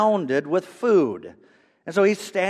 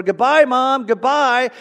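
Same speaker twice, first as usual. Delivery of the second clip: raised, carrying holler; shouted farewell; 7 semitones higher, 8 dB louder, slower than usual. Retold or imitated. Shopper calling to a lady at the exit door, shouting. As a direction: call out loud and high across a store. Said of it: "the man shouted out across the store".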